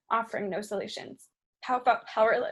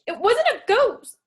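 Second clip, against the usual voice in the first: high, slow, loud, barely held in; forcing it out through anger; suppressed rage; 12 semitones higher, 7 dB louder, slower than usual. Acted. Pow insisting to the dismissive cop, shaking with rage. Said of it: "Pow's hands shook with rage".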